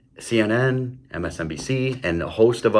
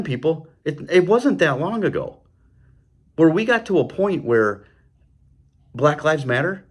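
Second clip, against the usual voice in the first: ordinary delivery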